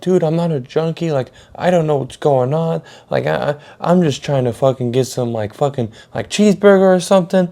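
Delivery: high, stumbling self-defence; flustered protest. Retold or imitated. Kid tells manager he's not a junkie, flustered high protest.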